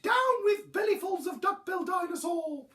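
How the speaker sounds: very high, loud duckbill hoot; high honking protest. A narrator's reading. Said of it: "he hooted".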